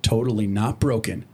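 usual speaking voice

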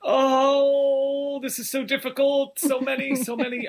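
high, wailing mock despair; mock anguish. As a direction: pretend it's an agonising choice. Agonising over favourite episode.

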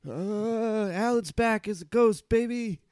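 spooky ghost voice. Acted. Alan returns as ghost.

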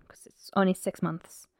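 hushed unvoiced whisper. Real, not acted. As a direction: drop to a secret whisper. Grim true-crime detail.

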